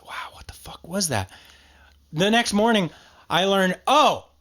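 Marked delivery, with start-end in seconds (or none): 0.1-1.3 hushed whisper; whispering so the wife can't hear. Retold or imitated. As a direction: whisper so the wife won't wake. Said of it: "whispering during the movie".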